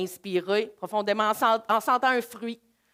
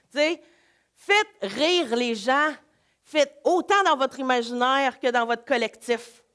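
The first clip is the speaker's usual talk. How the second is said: ordinary delivery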